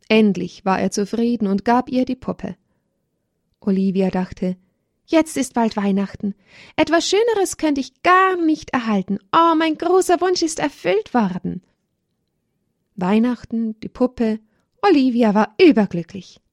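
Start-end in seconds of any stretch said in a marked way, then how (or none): 5.0-11.6 put-on high, dreamy child's voice; girl thinking happily of Christmas and her doll; happy little girl. A narrator's reading. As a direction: wish fulfilled, higher and delighted. Olivia thinks, overjoyed.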